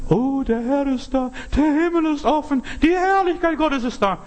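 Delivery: hammed-up high ecstatic gushing; mock ecstatic rapture. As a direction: parody an overexcited believer carried away. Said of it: "die denken".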